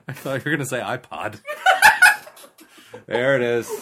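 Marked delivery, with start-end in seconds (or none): 1.5-3.0 high, loud burst of cackling; cackle. Real, not acted. Co-host cracking up at iPod gag, cackling. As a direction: cackle helplessly at the joke. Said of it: "The All Cackle".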